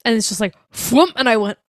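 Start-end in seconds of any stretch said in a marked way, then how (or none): 0.7-1.1 high, loud whoomp of a door; imitated door thud. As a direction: mimic a door slamming shut.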